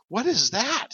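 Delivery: put-on a young woman jolted by something strange; startled niece. Fighter niece feeling her arm heat up, high startled cry.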